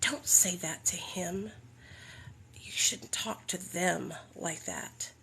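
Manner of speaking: hushed, slow scolding whisper. Retold or imitated. Sponsor scolding her in a hushed, slow whisper. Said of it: "she scolded me".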